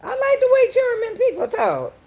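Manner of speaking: high, excited squealing; sudden high-pitched thrill. Real, not acted. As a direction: squeal with delight at the viewer. Excited reaction to a German viewer.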